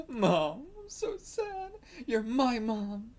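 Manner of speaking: put-on high, whining child voice with a slow, drawn-out ending; a kid pouting at mom for attention; whiny kid. Girl whining up at her mom for attention.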